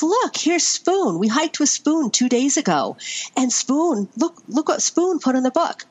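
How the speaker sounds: put-on high, eager child showing off a find; bubbling over about Spoon's logbook entry; excited son. Son showing mom the logbook, excited high voice. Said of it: "He goes".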